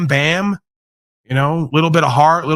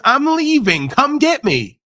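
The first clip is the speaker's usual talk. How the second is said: mock defiant, higher pitched dare; 10 semitones higher, slower than usual. An escapee daring the state to fetch him, higher mock dare. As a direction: taunt them to come and get you. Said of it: "The gambling is".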